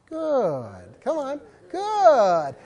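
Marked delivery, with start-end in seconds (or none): 0.1-1.0 "Good" with a long, low, soft vowel; drawn-out GOOD. Shown as a demonstration. Coach encouraging dogs, long-vowelled "Good". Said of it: "Two O's". 1.7-2.5 "Good" with a long, gliding higher vowel; drawn-out GOOD. Shown as a demonstration. Coach leading the crowd in encouragement, long "Good". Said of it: "Two O's".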